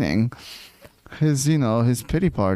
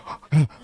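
mock gasp; 4 semitones higher than usual. Mocking Ken's excited reaction.

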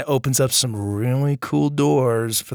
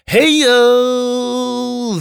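excited greeting; 10 semitones higher, 6 dB louder than usual. Real, not acted. Shout out to a patron.

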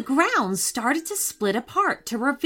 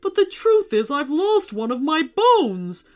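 a louder skeleton voice, sheepish and sorry; a big embarrassed monster owning up; apologetic giant skeleton; 4 semitones higher, 5 dB louder than usual. Acted. Skeleton voice held by narrator.

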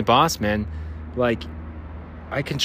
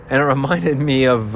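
ordinary delivery

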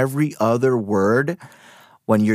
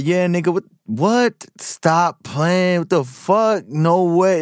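higher-pitched street accent, as with close friends; old neighborhood accent; 5 semitones higher than usual. Shown as a demonstration. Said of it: "it still comes out when I'm with my close friends".

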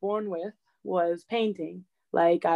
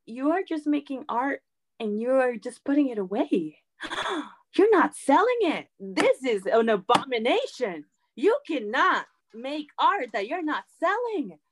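mock outrage; 5 semitones higher than usual. Scolding boyfriend for not selling art.

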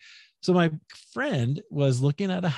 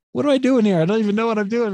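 high, loud disbelief at being there; high-pitched baffled self-quote; bewildered; 10 semitones higher, 5 dB louder than usual. Retold or imitated. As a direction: ask it like you can't believe where you are. Self quote, baffled at odd situation.

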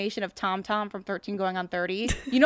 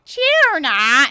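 louder, higher "Tuna" in a British way; mock British accent; 5 semitones higher, 10 dB louder than usual. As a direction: [name] joins the accent bit. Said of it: "[name] tries to join in".

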